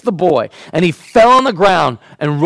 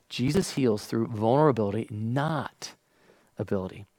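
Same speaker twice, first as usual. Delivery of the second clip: "vulnerability" said softly, much quieter; soft repeat; 5 semitones lower, 12 dB quieter than usual. Shown as a demonstration. Preacher repeating softly to the congregation, hushed. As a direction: hush it, let it sink in. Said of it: "let me say it again".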